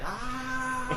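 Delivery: high drawn-out "ah"; full-auto yell. Acted. Mocking full-auto shooters, high drawn-out yell. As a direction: mimic spraying on full auto.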